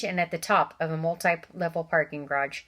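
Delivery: plain reading voice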